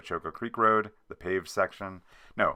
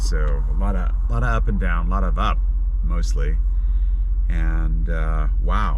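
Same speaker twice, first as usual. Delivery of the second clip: road noise; 11 dB louder than usual. Real, not acted. Recorded from moving vehicle.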